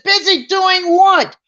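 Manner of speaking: high, loud indignant demand; retold angry snap. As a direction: throw the question back at him, mock-angry. Angry wife retold in car.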